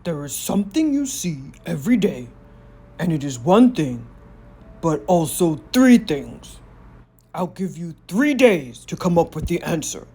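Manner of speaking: a smug giant daring them to try; gloating giant. Acted. Giant poses the riddle.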